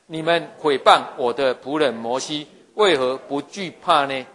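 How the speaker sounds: slow, loud unison recitation; scripture reading aloud. Real, not acted. Congregation reads verse eight aloud together, slow and loud. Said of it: "第八节我们一起念来".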